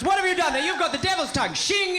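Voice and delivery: high, hammed-up British alarm; scandalised old gentleman. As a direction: play a stuffy Brit aghast at devilry.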